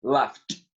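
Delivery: Laughed ends in a crisp T, said laft; Laughed pronounced laft, ending on T; past ending as T. Shown as a demonstration. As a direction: say Laughed with a final T sound. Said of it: "En pasado suena con T".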